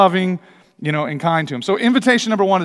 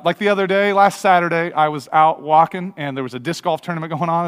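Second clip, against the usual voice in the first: usual speaking voice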